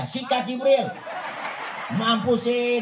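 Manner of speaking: high, eager go-ahead; gleeful yes. Acted. The speaker telling Jibril to go ahead, gleeful. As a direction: cheer him on high and laughing. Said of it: "Saya kata gitu".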